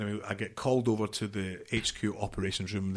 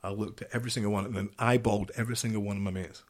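normal talking voice

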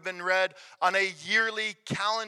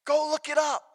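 raised, high-pitched urging; insistent challenge; 5 semitones higher than usual. Real, not acted. Preacher urges congregation to Scripture, raised insistent voice. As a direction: lift the voice and press it home. Same challenge repeated, building in force.

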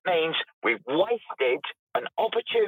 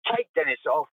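usual speaking voice

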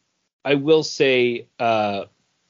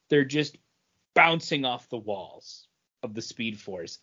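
ordinary talk

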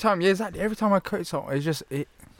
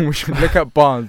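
laughing; 5 semitones higher, 10 dB louder than usual. Real, not acted. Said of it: "You're laughing".

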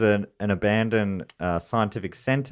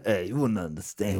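put-on Jersey accent; 5 dB quieter than usual. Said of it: "what's a Jersey accent again".

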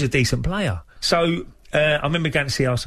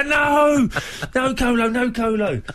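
manager's alarmed yell; 11 semitones higher, slower than usual. Retold or imitated. Manager yelling at Kolo after tackle.

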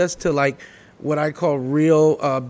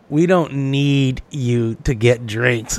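low, slow dismissive brush-off; snooty girls; 5 semitones lower, much slower than usual. Retold or imitated. Bar girls rejecting his offer of drinks, slow low sneer. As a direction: sneer the refusal slowly. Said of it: "they were like".

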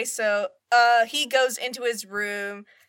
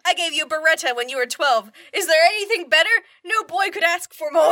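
higher, louder proud, laughing mum; mock proud mother; 6 semitones higher, 7 dB louder than usual. The mum boasts to her son about the Beretta, proud and loud. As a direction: brag like a proud gun-mad mother. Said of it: "the mum boasts of gift".

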